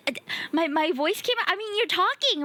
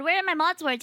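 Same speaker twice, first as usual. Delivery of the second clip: ordinary talk, nothing marked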